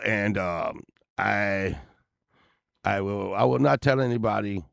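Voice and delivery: usual speaking voice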